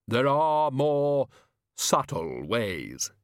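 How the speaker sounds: very slow, sinking pronouncement; stretched-out, sonorous voice of doom; Death, ominous. A narrator's reading. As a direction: intone it gravely, dropping at the end. Death answers Susan gravely.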